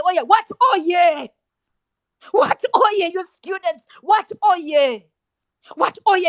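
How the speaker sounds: loud, high, scornful shouts; scornful challenge. Real, not acted. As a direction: throw the chant back loudly at the students. Mocking students' rallying call.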